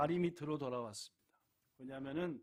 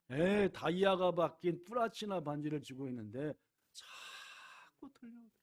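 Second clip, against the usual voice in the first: ordinary talk, nothing marked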